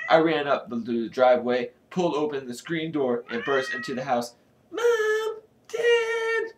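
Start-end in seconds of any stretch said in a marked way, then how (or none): 4.7-6.5 very high calling out; shrill, panicky call; urgent cry. A narrator's reading. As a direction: squeal for your parents. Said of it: "my cry was a hoarse".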